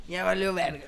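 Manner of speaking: ordinary talk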